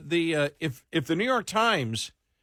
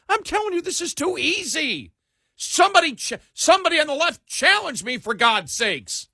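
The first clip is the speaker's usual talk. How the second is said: exasperated; 13 semitones higher, 4 dB louder than usual. Real, not acted. Exasperated challenge to the left.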